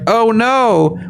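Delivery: high, put-on helpless alarm; hammed-up "woe is me" dismay; mock dismay. Mimics governments' belated alarm.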